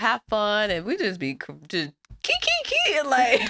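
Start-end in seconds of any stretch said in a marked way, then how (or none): 2.2-3.0 high-pitched "kee-kee" cackle; playful mock giggle, jumping way up; imitated giggling. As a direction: mimic cracking up in high squeaks. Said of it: "just cracking up".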